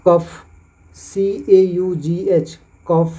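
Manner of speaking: slow spelling then कफ; slow spelling demo. Shown as a demonstration. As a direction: spell out for learners. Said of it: "उसका उच्चारण ऑफ से होता है". (धीमे कफ)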